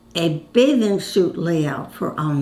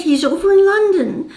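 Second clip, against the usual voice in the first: much higher, louder imitated distress; anxious plea; 11 semitones higher, 4 dB louder than usual. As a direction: mimic her rising panic. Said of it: "she was really serious about it".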